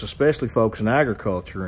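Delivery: ordinary talk, nothing marked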